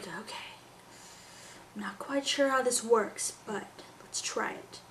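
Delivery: hesitant, soft puzzled mumbling; imitated confused baby. Mom voicing her baby's confused look, softly hesitant. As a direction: trail off softly, unsure but willing. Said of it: "she gets this confused, or, like, this look of".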